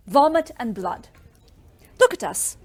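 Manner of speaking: ordinary delivery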